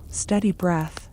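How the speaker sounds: usual speaking voice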